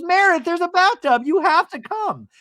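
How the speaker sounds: loud, high-pitched mock insistence; imitated urgent pleading. Heather urging Meredith to come, high loud pleading. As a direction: mimic a friend hollering to persuade.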